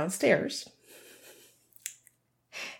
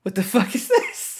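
outraged dog voice; 5 semitones higher than usual. Retold or imitated. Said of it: "He was like".

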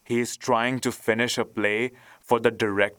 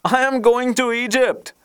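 high-pitched storybook call-out; excited cry; 12 semitones higher, 4 dB louder than usual. A narrator's reading. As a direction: announce it eagerly in a high voice. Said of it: "cried the swallow".

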